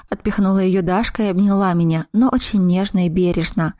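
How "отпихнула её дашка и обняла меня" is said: plain reading voice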